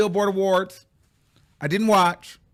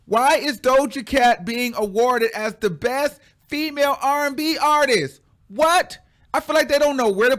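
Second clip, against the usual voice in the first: indignant; 7 semitones higher, 4 dB louder than usual. Real, not acted. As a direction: demand it in disbelief. Outraged at award result.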